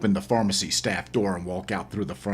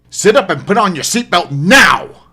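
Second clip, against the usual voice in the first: loud, high commanding shout; barked order; 11 semitones higher, 15 dB louder than usual. Acted. Narrator orders the woman to buckle up, loud shouted command. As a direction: yell the order over the engine.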